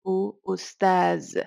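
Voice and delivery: "ustaz" ending in a buzzed z; drawn-out "ustaz" with a hissing voiced z. Shown as a demonstration. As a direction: finish "ustaz" on a z in place of th. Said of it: "or as a z".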